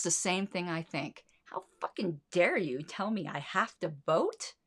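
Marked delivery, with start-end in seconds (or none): none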